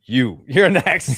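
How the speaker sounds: slow loud killer line with a laugh; slow menacing killer. Retold or imitated. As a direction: drag it out, laughing, like a slasher. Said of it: "Slow turn".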